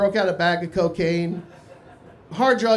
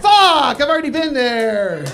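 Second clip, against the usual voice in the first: exasperated outburst; 5 semitones higher, 8 dB louder, slower than usual. Retold or imitated. Frustrated reaction to tour destination.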